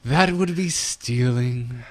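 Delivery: slow, drawn-out haughty elf tone; put-on snobbish elf voice; snooty Blood Elf. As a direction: stretch the words like a snooty elf. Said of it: "In the tone of".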